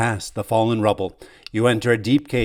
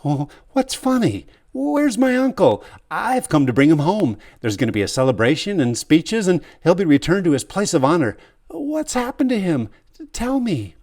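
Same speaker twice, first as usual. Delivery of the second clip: anxious demand; 8 semitones higher, 3 dB louder than usual. Acted. Hero demands answers.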